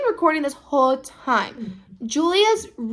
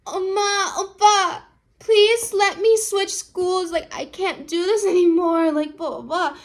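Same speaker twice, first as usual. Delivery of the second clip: pleading daughter; 7 semitones higher, 3 dB louder than usual. Retold or imitated. Julia begs her parents to switch schools, high whiny plea. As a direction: beg your parents for mercy.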